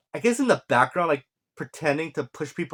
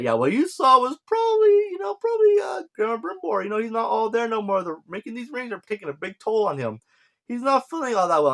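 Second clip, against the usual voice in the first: high, wheedling mock Sauron voice, much higher than his own; Sauron sowing doubt; 9 semitones higher than usual. Sauron whispering doubts to the elf, high wheedling mock voice. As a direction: play Sauron as a sly, squeaky schemer. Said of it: "Then we cut to Sauron talking to female elf".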